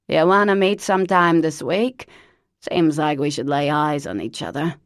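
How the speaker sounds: loud, put-on voice of an old chain smoker; Lavinia's horrible voice. Cousin Lavinia phoning Parker, loud put-on smoker's voice. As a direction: bark it out like a lifelong smoker.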